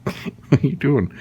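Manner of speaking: slow high sing-song pet talk. Real, not acted. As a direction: coo slowly at the puppy. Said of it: "reading to a toddler guess".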